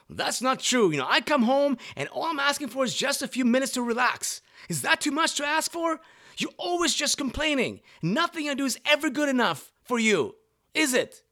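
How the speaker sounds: high, loud, indignant protest; defensive husband. Retold or imitated. Husband firing back at his wife in front of the counselor, a loud, high, indignant protest. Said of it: "Now, he would say something like".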